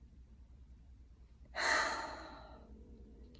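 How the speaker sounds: long exhale through the mouth; deep breath out. Shown as a demonstration. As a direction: breathe in, then let it all out in an airy sigh. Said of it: "and then out through your mouth".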